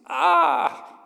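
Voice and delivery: sudden high yell of pain; sharp hurt scream from a fall. Acted. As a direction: cry out as if you just fell. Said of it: "and screams".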